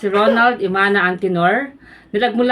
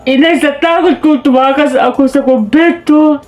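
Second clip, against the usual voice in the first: loud, anguished son pleading; anguished Basilio; 3 semitones higher, 6 dB louder than usual. Acted. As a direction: plead with your mother at full voice. Basilio recounts beating, begs mother. (malakas at nagdadalamhating pagsusumamo)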